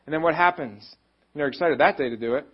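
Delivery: normal talking voice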